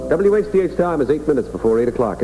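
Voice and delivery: normal talking voice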